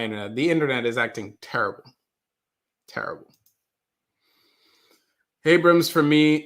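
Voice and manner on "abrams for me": normal talking voice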